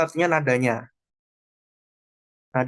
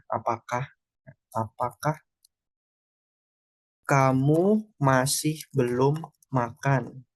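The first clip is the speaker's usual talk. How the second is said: slow translation read; much slower than usual. Shown as a demonstration. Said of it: "teacher reads translation slowly".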